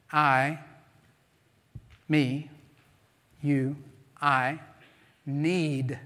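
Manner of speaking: "I", "me", "you", "need" each alone between long pauses; word-by-word emphasis. Shown as a demonstration. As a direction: let every word from "I" to "need" stand by itself, firmly. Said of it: "you emphasize each different word".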